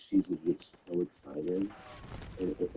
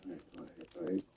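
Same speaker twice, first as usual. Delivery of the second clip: hushed, breathy-less murmured all right; whispered quote; 4 semitones lower, 8 dB quieter, faster than usual. Retold or imitated. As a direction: murmur it under your breath. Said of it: "I was like".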